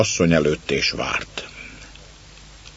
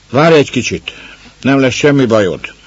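tossed-off reply; 7 semitones higher, 6 dB louder than usual. Acted. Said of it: "lökte oda egy másik".

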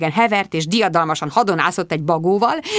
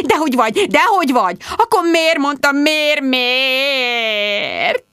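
fussing consolation; 7 semitones higher, slower than usual. A narrator's reading. Friends rush to comfort.

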